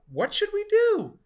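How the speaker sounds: high loud worried cry. Acted. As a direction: call out in alarm. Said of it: "The children cried out".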